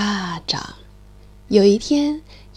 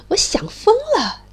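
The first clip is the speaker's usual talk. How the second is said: eager; 9 semitones higher than usual. Acted. Mole longs for pants.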